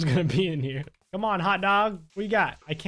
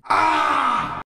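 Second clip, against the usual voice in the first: startled cry; 9 semitones higher, 7 dB louder than usual. Real, not acted. Game crashes mid-sentence.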